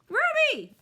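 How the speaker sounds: very high, loud happy call; loud delighted cry; excited exclaim. Acted. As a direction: shout her name in joy. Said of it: "I exclaim".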